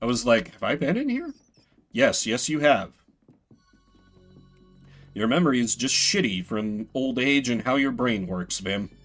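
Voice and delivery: ordinary delivery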